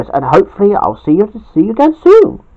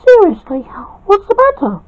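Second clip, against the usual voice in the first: much higher, concerned wife; Terry's voice. Acted. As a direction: pitch it up high and ask with care. Terry presses Jack on phone.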